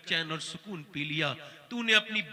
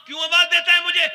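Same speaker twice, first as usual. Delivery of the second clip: high, loud and fast; loud question; 11 semitones higher, 14 dB louder, faster than usual. Acted. Mother answering her son's cry with a loud, fast demand. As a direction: fling the question back sharply.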